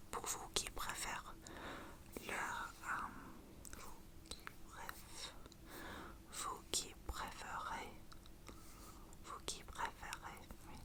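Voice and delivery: faint, breathy ASMR whisper. Shown as a demonstration. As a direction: drop to a barely there whisper. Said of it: "les chuchotements".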